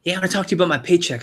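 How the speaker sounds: rep blurting out a complaint, fast. Retold or imitated. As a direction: rush it like an upset rep. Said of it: "Did you guys like that impersonation?".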